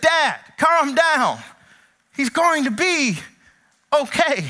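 a hint of a kid hushing his dad; son calming dad. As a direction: soothe an overexcited father. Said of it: "the son looks at him and said".

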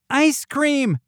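put-on very high kid's yell; high excited call, moderately loud. A narrator's reading. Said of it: "Cupcake yelled".

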